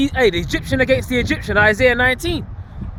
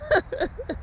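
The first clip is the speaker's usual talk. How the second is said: mock evil laugh; 14 semitones higher, 8 dB quieter than usual. Mocking at calamity, evil villains.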